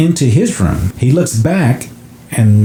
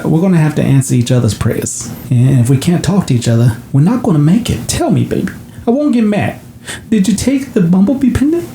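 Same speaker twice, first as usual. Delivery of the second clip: mom's voice climbing higher as she begs; pleading mom; 5 semitones higher than usual. Retold or imitated. Mother begs her son to tell her, pleading higher. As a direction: coax the truth out, gently rising high.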